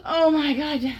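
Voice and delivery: loud pleading cries rising to a moan; impassioned cries. Acted. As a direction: act out mounting ecstasy, climbing to a wail. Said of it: "I gasp".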